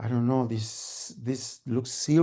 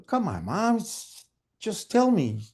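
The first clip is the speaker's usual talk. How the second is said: pleading; 5 semitones higher than usual. Acted. Son begging mom to tell him, pleading. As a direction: coax with a touch of exasperation.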